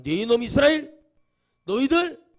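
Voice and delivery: loud, high cry of reproach; angry rebuke. Acted. God to Israel and the community, thundering rebuke. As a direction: shout down a whole people as an angry God. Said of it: "이렇게 드려야 돼요".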